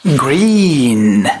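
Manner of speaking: "green" with a long, drawn-out ee; long ee vowel. Shown as a demonstration. Teacher models the colour word for students, long ee in "green". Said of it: "مش grain. لا، لا، لا، هي green".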